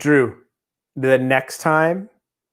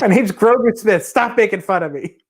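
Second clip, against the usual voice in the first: put-on higher whiny kid, complaining; 7 semitones higher than usual. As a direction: play the teased kid, whining. Acting out a teased kid.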